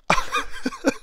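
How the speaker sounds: very high giggle of delight; short, high, giddy laugh; overwhelmed laugh. Real, not acted. Collector seeing his long-wanted toy, giddy laugh.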